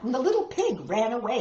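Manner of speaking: ordinary delivery, nothing marked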